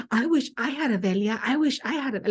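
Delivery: put-on high whiny wish, like a sulking kid; tantrum. Medium whining to herself like a kid in a tantrum. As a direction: whine it out like a child in a tantrum. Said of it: "like, having a tantrum".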